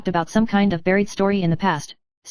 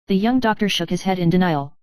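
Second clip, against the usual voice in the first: ordinary delivery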